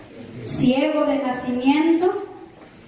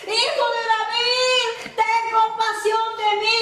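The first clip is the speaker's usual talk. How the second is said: high, urgent pleading call; acted high, stretched plea; forceful crying out; 7 semitones higher, slower than usual. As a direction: cry out for mercy over the crowd. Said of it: "gritó con más fuerza".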